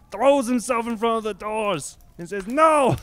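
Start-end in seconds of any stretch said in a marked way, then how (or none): 2.5-3.0 high, loud no; desperate no. Retold or imitated. Tarkanian blocking the doors from the owners, high loud no. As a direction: throw the word out as you block the door.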